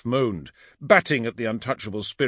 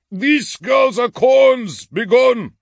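slow, high, full-force battle shout; 13 semitones higher, 10 dB louder, slower than usual. A narrator's reading. Orto orders the spirits away, shouting. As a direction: banish it with a bellow. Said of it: "shouted Orto".

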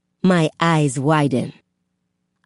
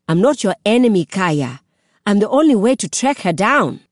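loud, pressing plea; urgent plea; 6 semitones higher, 4 dB louder than usual. A narrator's reading. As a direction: press her to believe. Pleading with Kaya.

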